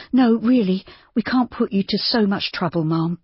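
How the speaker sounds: a lightly voiced young woman, flustered; Judy, anxious protest. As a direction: anxiously wave away the kindness.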